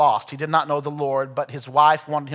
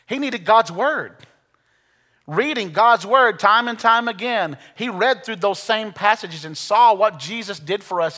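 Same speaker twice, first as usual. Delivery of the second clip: preaching at full force; 5 semitones higher than usual. Acted. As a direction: preach it to the back row. Pastor drives home the point.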